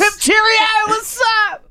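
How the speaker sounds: high, loud mock posh British accent; posh English accent. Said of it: "trying to sound like [name]".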